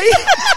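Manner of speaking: sharp loud burst of laughter; bright shrieking laugh; loud high laugh. Real, not acted. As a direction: laugh out hard. Laugh after greeting.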